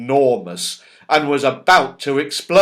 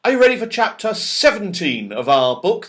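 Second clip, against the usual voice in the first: bright, higher storytime greeting; reading to children; 7 semitones higher, 4 dB louder, faster than usual. Acted. Reader opening the story to children, bright storytime voice. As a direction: welcome the little listeners warmly.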